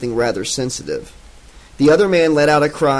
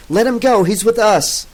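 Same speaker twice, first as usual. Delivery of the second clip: higher, pleading yell; 8 semitones higher, 3 dB louder than usual. A narrator's reading. Lynn begs the soldier to release Fredo, yelling higher. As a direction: shout her off him. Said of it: "Lynn yelled".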